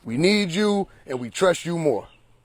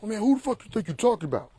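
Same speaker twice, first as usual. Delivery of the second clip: mock indignant; 7 semitones higher than usual. Acted retort to being called broke.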